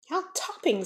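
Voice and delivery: thrilled at the sight; delighted. A narrator's reading. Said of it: "said John and Michael".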